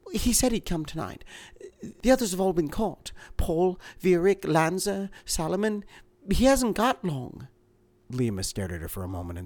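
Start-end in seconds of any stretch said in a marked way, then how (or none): 0.1-7.5 higher, anxious woman's voice; a frightened wife, urgent. A narrator's reading. Woman character in reading.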